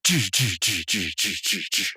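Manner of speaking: rapid string of mouthed hit sounds; imitated chop noises. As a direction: spit out repeated mock fighting noises. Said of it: "Rigby goes".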